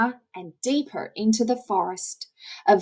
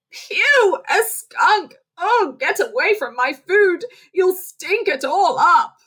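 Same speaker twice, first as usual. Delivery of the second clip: much higher, louder fussy disgust; disgusted squirrel; 8 semitones higher, 3 dB louder than usual. Acted. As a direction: protect the food from the stinky skunk. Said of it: "Squirrel, noticing Fred is a skunk".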